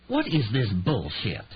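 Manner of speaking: slow, Southern drawl coming back; Southern drawl, contemptuous and slow; spat out in a Southern drawl. A narrator's reading. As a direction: spit it out, Southern accent in full force. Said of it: "Percy spat out, the vestigial drawl returning".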